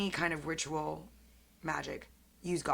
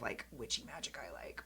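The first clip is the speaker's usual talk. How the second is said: whispering; 6 semitones lower, 13 dB quieter than usual. Real, not acted. Confides what magic she likes.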